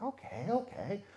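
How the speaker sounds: hushed agreement, barely there; whisper back. Retold or imitated. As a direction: answer in a soft whisper. Whispered reply at the door.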